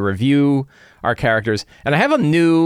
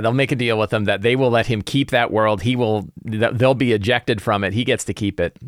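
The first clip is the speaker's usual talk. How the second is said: usual speaking voice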